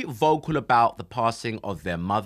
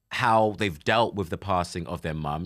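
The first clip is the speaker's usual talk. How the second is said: ordinary delivery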